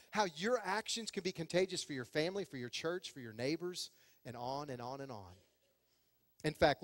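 usual speaking voice

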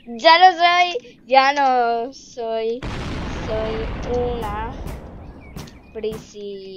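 drawn-out words, starting loud, fading; slow dictation. Real, not acted. Player typing "prisionera" in chat, dictating very slowly. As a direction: stretch "Ya no soy, soy una prisionera" word by word. Types the sentence while saying it.

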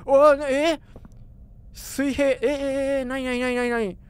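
ordinary delivery

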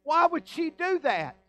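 acted conscience-stricken pleading, high and forceful; anguished bewilderment. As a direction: cry out in guilty disbelief, preacher-style. Voicing the guilty wrongdoer's bewilderment.

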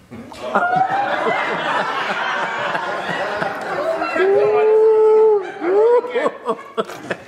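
big high burst of real laughter; real loud laughing. Real, not acted. Laughing at story.

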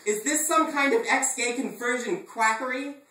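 high, loud scandalized protest; hammed-up outraged cry; indignant outburst. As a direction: rail against the quackery. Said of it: "I cried".

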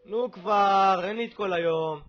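loud, exasperated hurry-up; impatient yell. Real, not acted. Gamer with no time to waste, yelling impatiently. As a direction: holler impatiently at a slow player.

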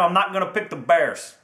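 ordinary talk

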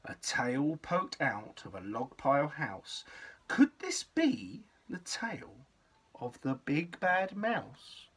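ordinary delivery, nothing marked

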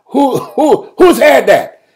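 high, loud rapid-fire interrogation with laughter; comic challenge. Acted. Doubter demands proof from Jesus, high loud mock interrogation. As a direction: fire test questions at him, loud and high.

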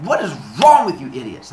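loud, punchy outburst; loud exasperation; exasperated scolding. Acted. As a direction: scold them in mock outrage. Prometheus scolds the humans.

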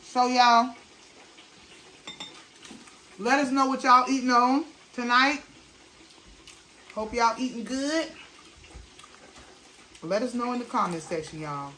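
speaking between bites; talking while chewing. Real, not acted. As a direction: mouth partly full of pasta. Seen 1: Man at left brings forkfuls to mouth between words; jaw working.

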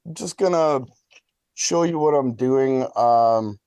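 usual speaking voice

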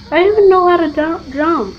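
high, loud and fast panic; panicked rush. Real, not acted. Gamer chased in the game, loud panicked rush.

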